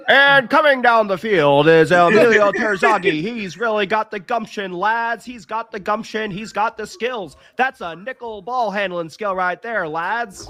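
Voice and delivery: put-on loud, high old-time radio play-by-play; old radio announcer. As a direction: call it like a 1920s radio announcer. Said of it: "Old-time radio voice".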